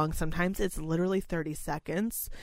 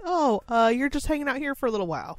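quick, higher, breezy reassurance; soothing caregiver; 6 semitones higher than usual. Retold or imitated. Caregiver soothes a confused resident, quick and lightly higher. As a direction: lightly play the caregiver smoothing it over. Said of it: "you just have to be like".

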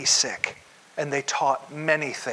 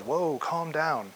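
slow and softer, easing them down; the rest of the world gently saying whoa, slow and soft; mock calm-down; 5 dB quieter, much slower than usual. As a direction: soothe an overeager friend. World reacting to American work ethic.

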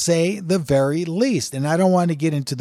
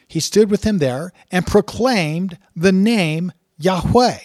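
louder, slow, proclaiming the verse; solemn scripture reading; 4 semitones higher, 6 dB louder than usual. Acted. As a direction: read it out like a proclamation from the pulpit. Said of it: "So let me just read it for you".

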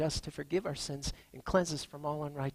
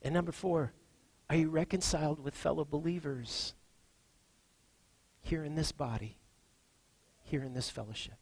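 ordinary delivery

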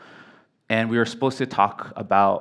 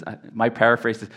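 ordinary talk, nothing marked

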